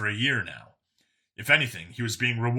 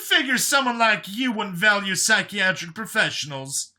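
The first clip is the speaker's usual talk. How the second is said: character voice, pitched up; a higher skeptical man; higher man's voice; 9 semitones higher, 3 dB louder than usual. A narrator's reading. Brian's voice in story.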